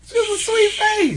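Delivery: very high, gushing falsetto coo; high-pitched gushing. Real, not acted. Host reacting to a sweet face, high-pitched gushing. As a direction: squeal it far up high. Gushing over the woman's photo.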